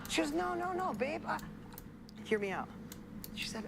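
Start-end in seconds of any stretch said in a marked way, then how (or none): 0.3-2.7 higher-pitched quick denial; lightly acted, pitched-up objection; retold protest. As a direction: act out the wife waving off praise.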